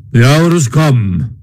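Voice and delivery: grave, solemn Badger voice; great solemnity. A narrator's reading. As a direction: pronounce it with weighty solemnity. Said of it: "said the Badger at last with great solemnity".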